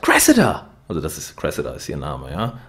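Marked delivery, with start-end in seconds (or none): 0.0-0.7 high, loud furious yell; angry shout. Retold or imitated. Alex yelling at his girlfriend through the car window. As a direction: bang the window and scream her name. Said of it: "wütend ... haut an die Scheibe und sagt".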